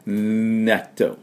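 納豆 with a long hummed N before the vowel; natto N sound. Shown as a demonstration. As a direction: hold the first N of 納豆. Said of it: "納豆の音". (納豆の「ん」を長くためる)

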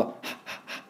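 unvoiced breath puffs like warming hands; breathy h sound. Shown as a demonstration. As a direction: puff air as if warming your hands. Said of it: "手に息を吐きかける時の".

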